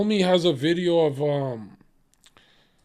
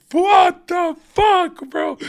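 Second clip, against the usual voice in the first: very high, very slow, louder yell; mock angry shout; 8 dB louder, much slower than usual. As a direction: drag it out in hammed-up fury. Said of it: "I'll be like".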